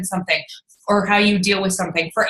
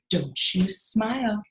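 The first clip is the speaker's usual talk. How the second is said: hint of a stern father; stern dad; 8 dB quieter, slower than usual. Dad scolding.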